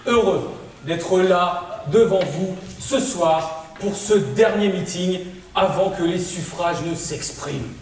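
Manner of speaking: lent et solennel, tribun de meeting; discours politique. Acted. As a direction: parle aux foules comme un président. (discours de meeting, lent et solennel)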